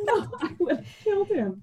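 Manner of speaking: high, laughing protest. Real, not acted. Answering a friend who asks about pictures, laughing. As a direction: say it through real laughter.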